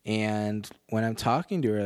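ordinary delivery

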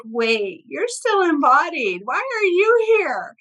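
high, slow, astonished wait, you're still embodied; surprised exclaim. Retold or imitated. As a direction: stretch out why are you here in disbelief. Medium surprised at a living spirit.

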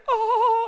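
high, drawn-out "oh" of adoration; mock onlookers' squeal; imitated crowd cooing. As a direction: gush in a high voice over a cute kid. Onlookers cooing over an animated toddler.

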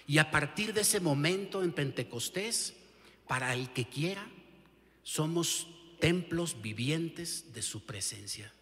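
ordinary talk, nothing marked

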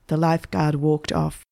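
usual speaking voice

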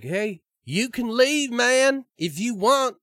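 high-pitched, drawn-out put-on voice; mock game host. Bosses offering the players a way out, high mock voice. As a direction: pitch it way up, stretching the words. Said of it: "It'd be like".

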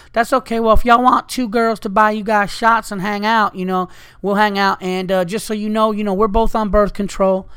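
high, loud and forward Southern voice; pushy Southern girl. Retold or imitated. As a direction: make the offer without blinking. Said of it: "she was, like, a little aggressive".

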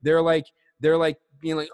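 usual speaking voice

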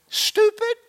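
a light mock insult, pitched high; self-scolding. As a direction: jab at yourself in a put-on voice. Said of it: "you said this to yourself".

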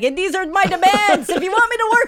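high, haughty list of demands; demanding diva. Retold or imitated. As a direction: lay down your demands like a diva. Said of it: "being like".

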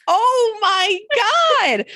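high, slow drawn-out oh my god; mock dismay. Real, not acted. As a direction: groan it in playful embarrassment. Caught out, mock despair.

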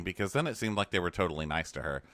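ordinary delivery

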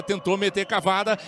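ordinary delivery

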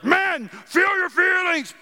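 high, drawn-out shout; mock shout. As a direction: shout it slowly at the men. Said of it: "normally I would say, like".

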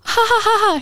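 high-pitched, awkward "ha ha ha"; forced fake laugh. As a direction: imitate a nervous polite laugh. Awkward laugh at boy's remark.

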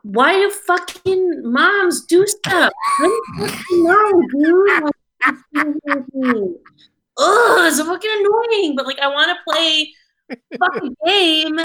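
exasperated shouting, near tears; frustrated rant. Real, not acted. Venting about mom watching gameplay.

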